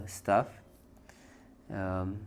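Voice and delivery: normal talking voice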